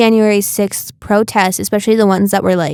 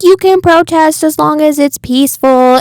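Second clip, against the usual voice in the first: mocking lecture; 7 semitones higher than usual. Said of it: "they were all like".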